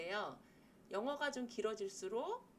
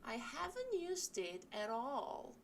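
slow model sentence; 2 semitones higher, much slower than usual. Shown as a demonstration. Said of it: "쓸 수 있는 표현이죠".

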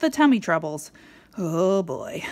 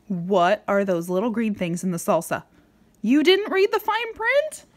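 ordinary delivery, nothing marked

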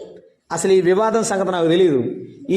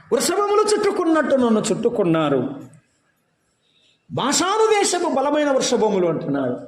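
raised preaching voice; 7 semitones higher than usual. Acted. Preacher reading the psalm to the church, raised. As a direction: lift the verse up high.

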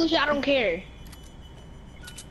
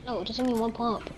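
normal talking voice

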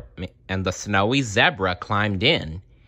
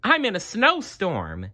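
delighted; 12 semitones higher than usual. Acted. Zebra delighted in flour.

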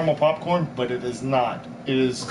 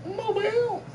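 high drawn-out; 4 dB quieter, slower than usual. Pretend. Echoing town name.

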